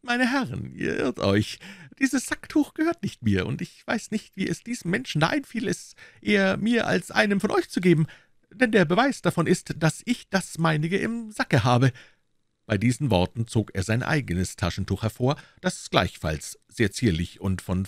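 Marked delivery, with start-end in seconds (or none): 0.0-11.9 very high, dainty musketeer voice; sugar-coat the denial in a much higher voice; sweet-mannered denial. A narrator's reading. Aramis denies the handkerchief to the gentlemen, sweetly. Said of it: "nahm er seine süße Miene wieder an".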